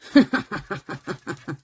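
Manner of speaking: short amused laugh; a quick laugh at himself. Real, not acted. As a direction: laughs off the hunch. Laughing at his own hunch.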